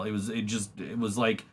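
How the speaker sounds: normal talking voice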